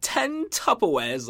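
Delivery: high, drawn-out mock British; British accent. Retelling an on-air slip to his co-host, mock British accent. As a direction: stretch it out in a silly British accent. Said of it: "in a fucking British accent".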